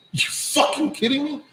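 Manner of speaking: high-pitched retold disbelief; incredulous. Driver told there's no parking, incredulous.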